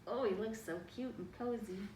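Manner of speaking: plain reading voice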